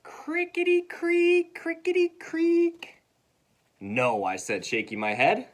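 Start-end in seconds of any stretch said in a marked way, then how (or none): 0.0-3.0 very high, slow squeaky creak; creaky rocking chair. Acted. Reader voices the old rocking chair, high slow creak.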